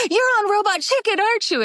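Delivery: high, gasping imitation of starstruck teens; excited fans. Teenage stagehands recognizing her from the show, imitated excited gasp.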